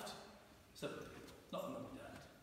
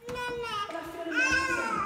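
high, loud burst of delight; joyful exclaim; 14 semitones higher, 8 dB louder than usual. Acted. Healed woman telling Jesus, loud and high with joy. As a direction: shout out how amazing she feels.

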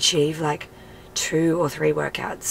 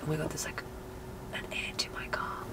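whispering; 13 dB quieter than usual. Real, not acted. Spotting an ant in the car.

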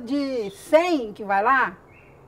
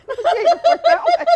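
high, loud, fast talk through laughter; laughing through words; 9 dB louder, faster than usual. Real, not acted. Still laughing to the host about the gate, words through laughter. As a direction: keep laughing while trying to talk.

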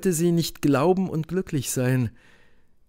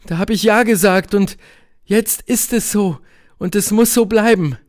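high, louder woman's voice, explaining urgently; agitated Helene; 7 semitones higher, 4 dB louder than usual. A narrator's reading. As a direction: justify it with urgency, pitched up. (erregte Helene, hohe Stimme)

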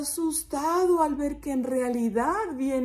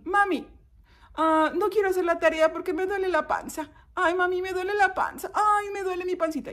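put-on high, whiny child complaining; child to mommy; 8 semitones higher than usual. Child faking pain to skip homework, whining. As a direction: feign a tummy ache in a high child voice. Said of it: "le dices a mami".

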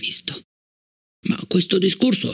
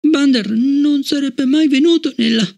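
put-on high child's voice, louder and choked; strangled voice; 13 semitones higher, 4 dB louder than usual. Shocked Fallon answering Bliss, strangled high child voice. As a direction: gasp it out in shock. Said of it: "disse con voce strozzata".

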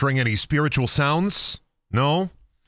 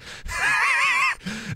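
laugh. Real, not acted. Grandpa bit ends in laugh.

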